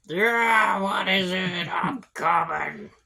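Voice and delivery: put-on old man hollering from the back rooms; calling from afar. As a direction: shout it to someone at the far door. Old man calling through door.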